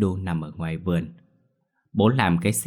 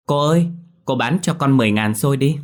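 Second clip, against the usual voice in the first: calling out; 6 semitones higher, 5 dB louder than usual. Acted. Calling to passing xôi seller.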